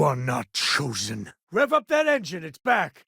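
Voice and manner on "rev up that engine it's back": much higher, thrilled call; elated shout about the engine; excited cheer. Acted. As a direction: whoop it out like good news just landed. Ship back online, cheering.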